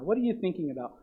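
normal talking voice

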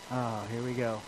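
low, quiet resigned aside; here-comes-trouble grumble; weary dread. Retold or imitated. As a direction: sigh it out under your breath, resigned. His dread at rebellious kid coming.

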